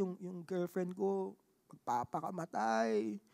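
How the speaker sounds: slow, quiet, heavy; grave hush. Retold or imitated. Friend revealing girlfriend's crisis, slow and hushed. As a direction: drop it low like a secret too heavy.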